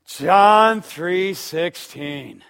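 imitating Kay's slow, deliberate recital of the reference; her exact inflection. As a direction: drag each word out, flatly matter-of-fact. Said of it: "this is exactly how she said it with the same voice inflection".

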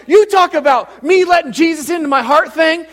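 loud, high-pitched South Side gal; Teresa impression. Parishioner telling pastor about the message, loud high impression. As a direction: belt it out as a brash Italian churchgoer. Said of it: "That's exactly how she talks".

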